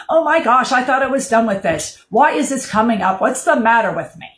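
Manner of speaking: high, loud, dismayed outburst at herself; exasperated self-talk. Retold or imitated. Griever scolding herself for grief coming back, loud dismay. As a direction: voice the griever's frustrated inner panic. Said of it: "If you're just like".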